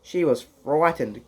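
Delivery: plain reading voice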